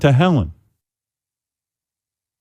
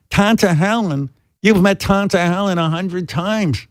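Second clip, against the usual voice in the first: mother voice; 6 semitones higher than usual. Retold or imitated. Son voicing his mother.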